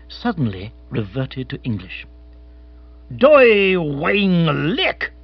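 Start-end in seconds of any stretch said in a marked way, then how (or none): 3.1-5.1 slow, drawn-out foreign exclamation, a touch louder; an old colonial captain crying out in dialect. A narrator's reading. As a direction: bellow each syllable out slowly like a sudden discovery. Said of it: "he cried".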